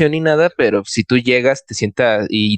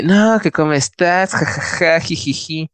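put-on slow, high, giggly drunk greeting; tipsy giggle; 5 semitones higher than usual. Drunk date arrives late, greets him with a slow high giggle. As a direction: play her tipsy and giggling.

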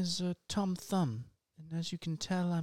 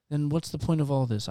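ordinary talk

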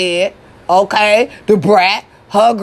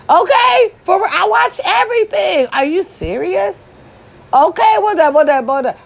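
high, hyped-up bragging; excited boasting; 11 semitones higher than usual. Acted. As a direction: brag with a squeal.

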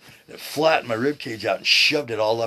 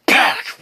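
sudden very loud, high crack; imitated bone pop; 13 semitones higher, 12 dB louder than usual. Man retelling his collarbone snapping out, imitated loud pop. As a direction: burst out the noise of a bone popping.

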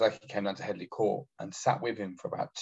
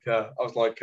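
normal talking voice

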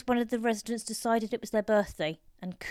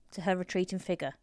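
ordinary delivery, nothing marked

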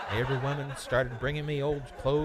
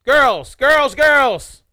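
calling out; 8 semitones higher, 14 dB louder than usual. Acted. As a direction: shout over the room. Calling the room to order.

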